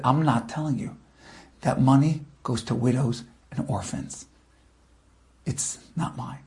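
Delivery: low and soft, fading to a whisper; hushed refusal. Acted. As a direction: hold firm in a near whisper. Beaten rabbi refuses thugs.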